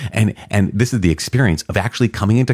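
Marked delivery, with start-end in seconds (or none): none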